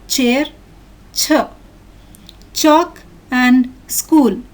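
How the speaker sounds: slow, separated words, "chameleon" with "k" and "chair" with "ch"; listen-and-repeat pronunciation. Shown as a demonstration. Teacher modelling contrasting sounds for children, slow careful dictation. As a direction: model "school", "k", "chalk", "ch" word by word. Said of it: "Listen and repeat these words".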